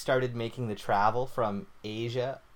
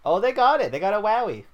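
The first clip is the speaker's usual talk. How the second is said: louder, higher, insisting; loud insistence; 9 semitones higher, 8 dB louder, faster than usual. Real, not acted. He loudly insists Australia has the wowie. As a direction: push the claim hard.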